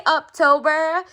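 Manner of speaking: "October" said as UP-tober, pitched high and loud; Uptober pun. Shown as a demonstration. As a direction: say "October" with "up" as the first syllable. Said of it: "they say October, it's going up".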